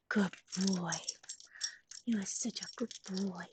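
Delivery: low unvoiced whisper praising the dog.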